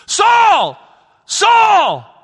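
high, loud booming call; a commanding divine shout; voice of Jesus calling. Acted. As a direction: call out his name from above.

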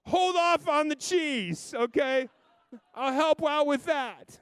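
high, slow, loud cautious reply; gently head off the knife; mock careful dad. Dad worried about knives.

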